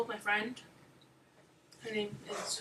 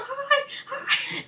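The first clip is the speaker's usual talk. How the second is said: very high squealed hellos with a laugh; greeting while falling. Retold or imitated. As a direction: squeal it as if dropping on a ride. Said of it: "and you're falling".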